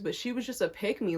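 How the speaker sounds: usual speaking voice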